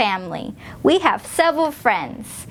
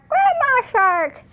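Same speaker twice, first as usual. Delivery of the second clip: slow, much higher wobbly granny voice; grandma voice; 11 semitones higher, slower than usual. Shown as a demonstration. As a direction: say it like a sweet old grandma.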